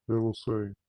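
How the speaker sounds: normal talking voice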